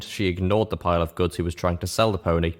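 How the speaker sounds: ordinary delivery, nothing marked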